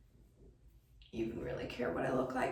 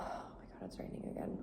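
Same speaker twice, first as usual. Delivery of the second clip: whispering; 11 dB quieter, faster than usual. Real, not acted. Sees rain, mutters to self.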